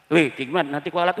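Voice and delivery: ordinary talk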